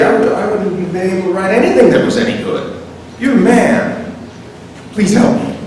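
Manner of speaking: high-pitched gushing admiration; awestruck devotion. Acted. Dante recognizing his leading light, awestruck. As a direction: gush at your idol, then plead.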